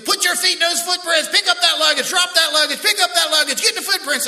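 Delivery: high, loud barked commands; drill instructor yelling. Retold or imitated. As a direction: snap the orders out fast. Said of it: "they're telling you".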